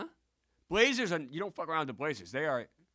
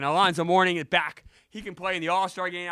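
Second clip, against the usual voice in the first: loud, higher excited burst; 5 semitones higher, 9 dB louder than usual. Retold or imitated. Streamer explaining the thought that thrilled them, excited. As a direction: relive the thrill, raising your voice. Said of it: "I got very excited".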